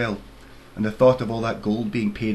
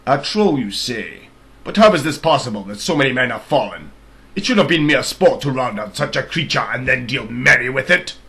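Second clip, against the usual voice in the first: loud, stern royal accusation; accusing king; 2 semitones higher, 8 dB louder than usual. Acted. King accuses the soldier of cowardice, loud and stern. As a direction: thunder the charge of cowardice.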